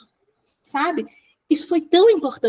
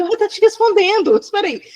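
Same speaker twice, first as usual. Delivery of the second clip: high, louder retort, retold; mock objection; 9 semitones higher, 5 dB louder than usual. Therapist retelling how she objected to the coordinator, high and insistent. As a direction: break in high and insistent, half amused.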